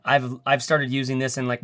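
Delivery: ordinary talk, nothing marked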